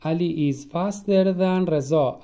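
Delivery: slow, careful example sentence; slow model sentence. Shown as a demonstration. Teacher to students, slow example sentence.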